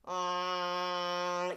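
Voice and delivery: pinched, strongly nasal ah with the tongue touching the roof of the mouth. Shown as a demonstration. Coach shows the tongue touching the ceiling, very nasal. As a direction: squeeze the ah right through the nose. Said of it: "and even touch the ceiling with it".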